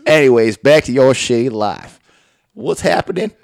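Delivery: loud mock TV host segue; hammed-up host getting back to business; Doctor Phil. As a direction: steer the show back to the guest. Mimicking host returning to the guest.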